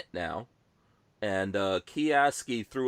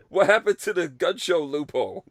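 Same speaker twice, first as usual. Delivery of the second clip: mock outrage; 7 semitones higher, 6 dB louder than usual. Mocking buyer.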